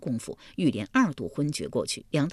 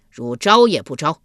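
loud bailiff's demand; stern shout; 8 dB louder than usual. A narrator's reading. Guard interrogating Yulian, stern shout. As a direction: bark it out as a guard demanding confession. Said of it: "侍卫喝道".

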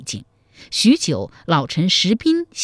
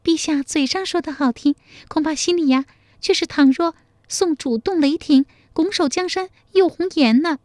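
high-pitched, coy lady's voice; high, flirtatious mock complaint; coquettish pouting; 11 semitones higher, faster than usual. A narrator's reading. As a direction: chide the emperor sweetly with a pout. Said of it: "娇嗔说".

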